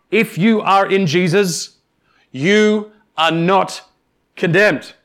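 "you" stressed, words drawn out slowly; slow emphatic assurance. Acted. Preacher assuring believers, slow and emphatic. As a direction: spell it out slowly to reassure.

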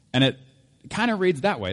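normal talking voice

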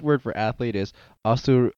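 ordinary talk

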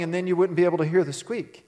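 normal talking voice

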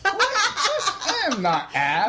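high, slow, laughing protest; laughing denial. Real, not acted.

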